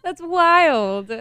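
high, loud amazed what; surprised. Real, not acted. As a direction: disbelief bursting out. Amazed reaction.

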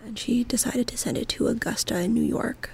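soft, hushed archive whisper. Real, not acted. Researcher traces the letter's chain in the library, whispering. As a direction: murmur it quietly among the files. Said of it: "we had to whisper because, you know, library rules".

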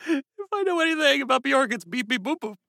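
high, airy beeping voice. Retold or imitated. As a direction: coo the beeps up high.

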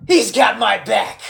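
loud, slow wrestler boast; Dolph Ziggler. Retold or imitated. Podcaster imitates wrestler bragging about his backup, loud. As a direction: belt it out slowly like a promo. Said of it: "Dolph Ziggler has been calling".

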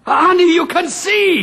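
high, joyful cry of astonishment; overjoyed amazement. Acted. Husband to his wife whose sight returned, overjoyed. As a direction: burst out with the miracle.

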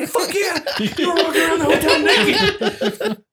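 high, loud, fast laughing taunt; gleeful laughing. Retold or imitated. Friend gleefully telling him he walked the hotel naked, laughing. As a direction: crow it through laughter.

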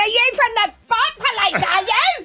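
high, loud mocking challenge; shrill sarcastic retort. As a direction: snap back high and loud. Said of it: "And I went".

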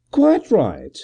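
a gentleman amused and at ease; cheery agreement; cheerful. A narrator's reading. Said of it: "Gervase answered cheerfully".

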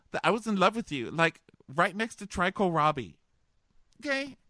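high, breathless rush of heartbreak; mock upset contestant. Jilted contestant rambling to the bachelor, high rushed mock voice. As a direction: gush it high and fast like a jilted girl. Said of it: "And she goes".

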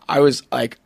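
ordinary talk, nothing marked

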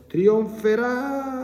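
very high drawn-out Trionferà; prolonged shout. Retold or imitated. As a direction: stretch Trionferà into a long high yell. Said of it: "urlando tremendamente e prolungatamente". (Trionferà urlato a lungo e acuto)

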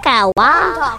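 normal talking voice

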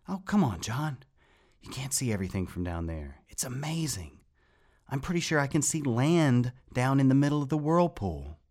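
thrilled and breathless with wonder; excited. A narrator's reading. Excited Rat on the railing.

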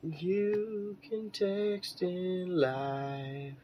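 slow, high announcer drawl; radio announcer voice. Acted. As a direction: stretch it out like a DJ. Announcing to listeners.

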